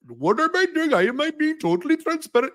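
high-pitched, exaggerated Indian accent; mock Indian accent. Shown as a demonstration. Demonstrating how the Indian accent sounds nerdy, mock Indian accent. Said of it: "You have the Indian accent".

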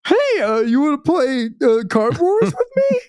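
squeaky dorky friend asking shyly; Ned impression. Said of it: "I'll do a Ned impression real fast".

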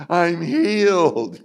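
hammed-up rapture; mock ecstatic. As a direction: proclaim it like a sudden miracle. Mock instant healing.

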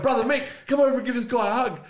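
high, loud call across a bar; calling across a pub. Retold or imitated. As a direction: shout to a brother across the room. Calls his brother across the bar.

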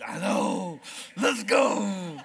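loud eager burst; excited go. Retold or imitated. Hunter given the keys, an excited burst. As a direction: fire yourself up to go.

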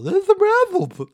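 very high, loud, slow goofy Neanderthal; Paul. Retold or imitated. Friend imitating Paul eating candy, weird high Neanderthal voice. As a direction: drag it out in a big high caveman voice. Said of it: "That's what we used to read Paul".

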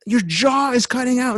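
high frantic pleading, lightly done; mock panicked bystanders. Bystanders pleading with the hurt guy, mock panic. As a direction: imitate people panicking over the injured man. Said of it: "like, screaming, going".

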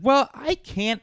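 high, loud protest; emphatic retold refusal. Refusing the collector's demand, emphatic and high. Retells refusing to pay the fines.

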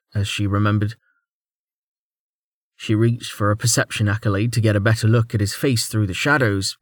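ordinary delivery, nothing marked

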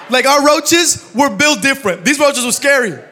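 loud laughing exclamation; laughing loudly. Real, not acted. Preacher laughing to his congregation about roaches. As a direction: laugh it out big to the crowd.

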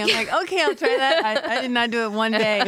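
high-pitched, amused retelling; amused, laughing confession. Real, not acted. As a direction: tell it through laughter. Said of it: "I'm laughing".

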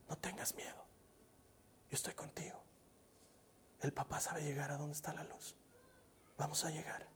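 put-on soft hushed whisper into a baby's ear; whispering comfort. Father whispers to his scared baby in the dark hallway, hushed. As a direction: soothe a frightened baby, barely voiced. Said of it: "empecé a hablarle a su oído".

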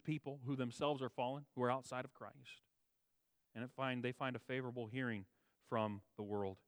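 ordinary delivery

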